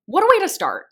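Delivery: high laugh after the line; amused high chuckle. Real, not acted. Amused at dark pick.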